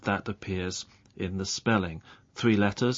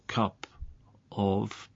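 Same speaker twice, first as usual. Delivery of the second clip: "cup of" slowly, with a clear pause between the words; separate words; 5 dB quieter than usual. Shown as a demonstration. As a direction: keep cup and of apart, each in full.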